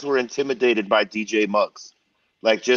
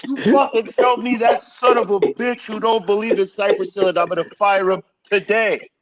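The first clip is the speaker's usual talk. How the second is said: angry boss; 8 semitones higher than usual. Retold or imitated. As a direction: bark it like a label president who's had enough. Donnie scolding the group, retold.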